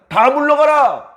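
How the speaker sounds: high, loud commanding shout, drawn out slowly; sudden shout. Acted. Joseph shouting at his Egyptian servants to leave. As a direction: bellow the order to clear the room. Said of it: "갑자기 소리를 질렀을 때".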